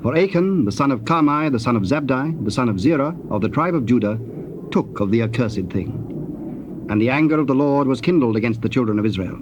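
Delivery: ordinary delivery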